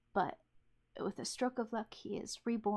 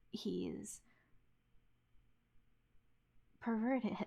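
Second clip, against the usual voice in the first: whispered; slower than usual. Real, not acted. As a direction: drop to a whisper on perverted. Taboo word whispered aside.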